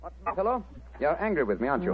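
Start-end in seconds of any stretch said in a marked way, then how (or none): 0.0-0.6 softer, higher, excitement held down; suppressed excitement. Acted. Holmes to Watson on the walk home, hushed excitement. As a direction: keep the thrill under your breath. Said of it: "a note of suppressed excitement in his voice".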